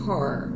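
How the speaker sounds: car with a strong American R; American English pronunciation. Shown as a demonstration. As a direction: roll the R at the end of car. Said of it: "Car, inglês americano".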